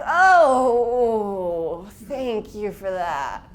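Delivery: loud, drawn-out sarcastic thank you. Retold or imitated. Daughter stung by mom's remark, loud mock thanks. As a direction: fake gratitude, slowly. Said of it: "I was like".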